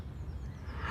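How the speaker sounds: breathy puppy yawn; big sleepy yawn. A narrator's reading. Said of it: "he yawned".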